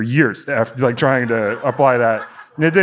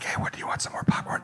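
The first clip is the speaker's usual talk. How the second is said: quick, hushed cinema whisper; imitating polite moviegoers whispering; mock whisper; 11 dB quieter, much faster than usual. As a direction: whisper it discreetly to the person beside you.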